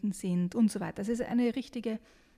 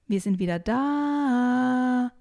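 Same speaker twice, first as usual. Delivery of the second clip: higher call with long final vowel; 4 semitones higher, 4 dB louder, much slower than usual. Acted. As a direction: announce it across a lake. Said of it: "rufe ich".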